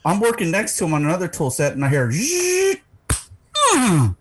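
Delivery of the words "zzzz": high imitated robot buzzing. Storyteller mimics the robot arm hitting a head, high buzz.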